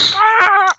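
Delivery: squawk. Acted. Bird call mid-sentence.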